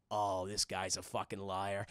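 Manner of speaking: slow, dark remark to oneself; suspicious aside. Retold or imitated. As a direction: drop low as the unconvinced villain. Villain's suspicious reaction, retold.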